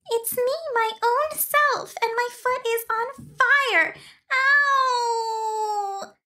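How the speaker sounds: very high tiny fairy voice, wailing; a tiny fairy child wailing about her burned foot. A narrator's reading. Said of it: "she wailed".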